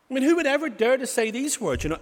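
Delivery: ordinary talk, nothing marked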